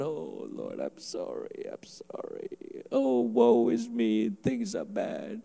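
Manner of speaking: very soft, low, slow mock lament; timid. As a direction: murmur a feeble, woeful prayer. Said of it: "Not the most timid one".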